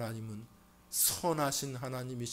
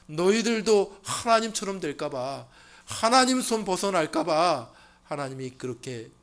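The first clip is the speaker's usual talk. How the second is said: Satan's whisper of doubt building to a loud high push; coax, then press harder and louder; tempter's voice; 5 semitones higher, 12 dB louder than usual. Retold or imitated. Satan sowing doubt in Adam and Eve, building loud. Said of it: "그러나 사탄은".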